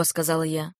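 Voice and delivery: plain reading voice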